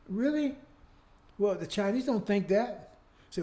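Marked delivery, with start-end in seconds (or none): 0.1-0.6 a high, incredulous scoff; sarcastic really. Real, not acted. Preacher scoffing at claims of the best country, high sarcastic disbelief. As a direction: toss it back, sharply disbelieving. Sarcastic retort to nation boast.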